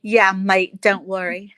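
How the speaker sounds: loud, put-on Australian "mate" talk; mock Aussie accent. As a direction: echo a friend in a playful Australian accent. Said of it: "you were doing the Aussie accent".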